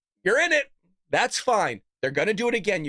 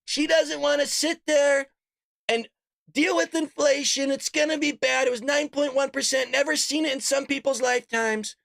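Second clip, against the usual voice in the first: mock Trump; 6 semitones higher than usual. Mocking Trump on the debate stage, higher put-on voice. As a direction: ham up Trump warning about inflation.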